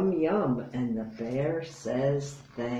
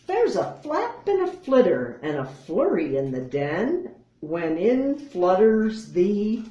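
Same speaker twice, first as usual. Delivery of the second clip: ordinary delivery, nothing marked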